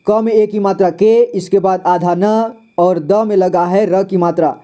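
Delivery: ordinary talk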